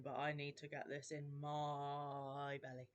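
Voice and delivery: talking between sips of tea; slurping tea while speaking; gulping a drink. Real, not acted. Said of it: "sorry for slurping and gulping".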